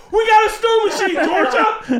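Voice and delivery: very high giggling cheer; high squealing laughing kid; laughing gloat. Retold or imitated. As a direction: crow to your sister.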